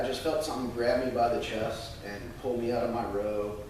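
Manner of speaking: ordinary talk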